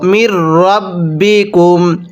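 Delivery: মির রাব্বিকুম with ম joined straight into a doubled র; merge mim into ra. Shown as a demonstration. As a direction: link মির into রাব্বিকুম with no break. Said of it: "মিমকে রয়ের সাথে লাগাবো".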